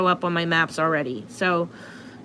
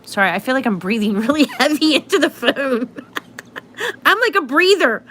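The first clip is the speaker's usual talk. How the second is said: higher, giggling through the words; laughing; 5 semitones higher, 3 dB louder than usual. Real, not acted. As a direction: giggle while apologizing. Laughing at own heavy breathing.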